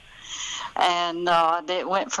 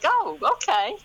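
naive oh okay; 10 semitones higher than usual. Retold or imitated. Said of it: "It was like".

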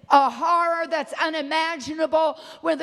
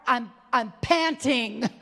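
usual speaking voice

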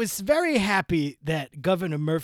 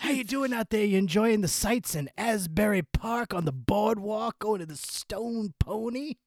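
[name] voice; 5 semitones higher than usual. Pretend. [name] character urging the co-host to see live rock. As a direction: preach rock and roll like a revival. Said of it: "Why is [name]?".

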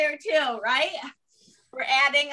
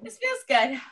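tag laughing; 7 semitones higher than usual. Acted. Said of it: "once you start laughing, you're like".

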